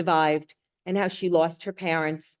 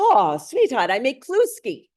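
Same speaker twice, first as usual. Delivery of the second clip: put-on Eastern European accent; 4 semitones higher, 6 dB louder than usual. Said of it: "I do the worst Eastern European accent".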